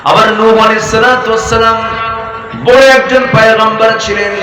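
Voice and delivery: loud, high preaching cry; fiery sermon voice; full-force preaching. Acted. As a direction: preach it hard to the crowd.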